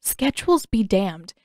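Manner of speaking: plain reading voice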